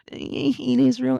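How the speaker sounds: mock your own ranting self; mocking whiny poster. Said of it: "that was just me being like".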